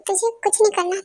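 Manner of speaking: ordinary delivery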